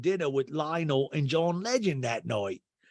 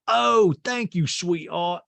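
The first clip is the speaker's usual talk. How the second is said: loud, high, drawn-out imitation; gushing mock thanks; 6 semitones higher, 6 dB louder, slower than usual. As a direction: overplay thanking someone for a gift. Acted thanks for a gift.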